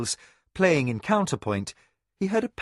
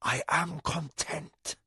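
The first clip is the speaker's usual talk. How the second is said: quiet; 4 semitones higher, 6 dB quieter, slower than usual. A narrator's reading. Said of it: "he whispered".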